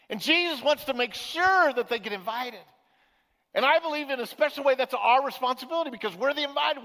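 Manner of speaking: preaching. Real, not acted. Pastor telling the church its duty, emphatic preaching. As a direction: drive the point home to the pews.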